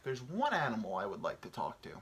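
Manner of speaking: ordinary delivery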